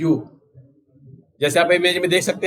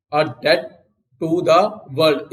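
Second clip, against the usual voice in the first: slow repeat; much slower than usual. Shown as a demonstration. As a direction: split "a debt" from "to the world" with a pause, slowly. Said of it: "teacher repeats the phrase slowly". (धीरे-धीरे, रुक-रुक कर बोलिए)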